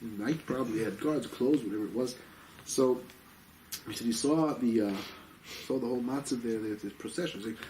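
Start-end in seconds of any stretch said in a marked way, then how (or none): none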